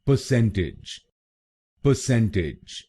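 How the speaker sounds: Percentage with the weight on सेन; percentage, middle syllable सेन stressed. Shown as a demonstration. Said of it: "CEN पर जोर डालना है".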